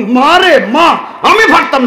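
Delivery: high, hammed-up cry of dread; alarmed refusal. Acted prophet recoils from the plea.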